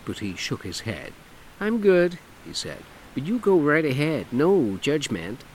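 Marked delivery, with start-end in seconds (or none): 1.6-5.4 camp it up, lilting and showy; flamboyant man. Acted. Dougie voiced in story.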